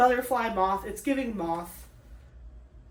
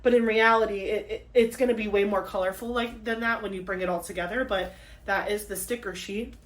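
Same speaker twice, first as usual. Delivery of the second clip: ordinary talk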